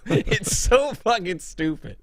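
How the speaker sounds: normal talking voice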